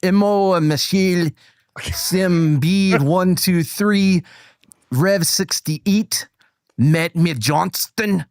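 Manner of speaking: slow, put-on Scottish accent; badly faked Scottish accent; attempted Scottish accent. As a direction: read names in a laboured fake Scottish. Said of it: "so Scottish accent?".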